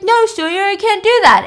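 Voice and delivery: high, loud, panicked no; alarmed refusal. Retold or imitated. David refusing Sawyer's demand, high loud panicked protest.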